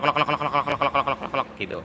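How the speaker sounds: rhythmic mouth-made klok klok knocks; imitated knocking. Shown as a demonstration. Mechanic showing a scooter owner the rattle, clicking klok sounds. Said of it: "ini timbul suara klok".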